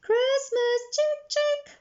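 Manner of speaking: very high, loud wail; wailing call. A narrator's reading. Jamila loses Christmas in the crowd, wailing. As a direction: wail out for the lost chicken. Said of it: "wailed Jamila".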